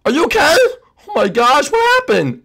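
very high, panicky cry; alarmed concern. Acted. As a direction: shriek your worry after a crash. Friend has just crashed.

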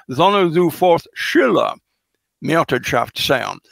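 put-on Old English verse read aloud. Shown as a demonstration. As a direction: recite the Anglo-Saxon text in period pronunciation. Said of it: "she says, uh, in, in Anglo-Saxon".

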